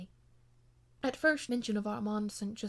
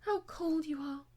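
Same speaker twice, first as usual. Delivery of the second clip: a slow, higher, sighing complaint; sighing reproach; 6 semitones higher than usual. A narrator's reading. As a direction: let the words fall out on a sigh. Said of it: "she sighed".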